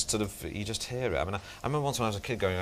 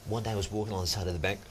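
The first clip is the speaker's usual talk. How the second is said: [name]; 2 semitones lower than usual. Shown as a demonstration. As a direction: tell it hushed, then jump in level. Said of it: "[name] is exactly the same".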